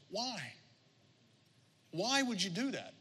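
soft, high, feeble plea; dying frog. Acted. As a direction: play a dying creature's hurt question. Dying frog asks scorpion why.

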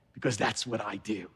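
low, understated divine assurance; hushed punch line. Acted. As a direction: drop right down for a quiet, sure reveal. Savors God's punch line.

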